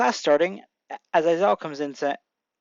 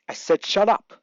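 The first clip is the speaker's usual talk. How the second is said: mildly irritated, raised pitch; annoyed; 4 semitones higher, 3 dB louder than usual. A narrator's reading. Teacher silencing his class, annoyed. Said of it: "Aizawa, annoyed".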